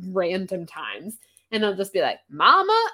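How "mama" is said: high, loud hammed-up Southern call for mama; mock Southern kid calling mama; bad Southern accent. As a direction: call out like a backwoods kid, badly faked Southern. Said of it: "he's not good at doing a Southern accent".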